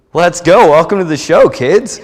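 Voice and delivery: high, loud hype with a laugh; pumped welcome. Retold or imitated. Star player welcoming the bat boys aboard, pumped and loud. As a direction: fire up the rookies.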